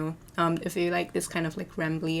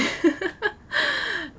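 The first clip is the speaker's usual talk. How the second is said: high squeaky giggle. Real, not acted. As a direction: burst out in a high little laugh. Laughs at own voice joke.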